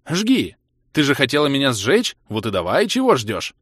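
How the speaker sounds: loud taunting challenge; angry dare. A narrator's reading. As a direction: dare her to go through with it. Narrator dares her to burn him.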